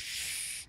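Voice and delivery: long soft unvoiced hiss like a swan. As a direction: hiss through the teeth like an angry swan. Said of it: "it hisses like one as well".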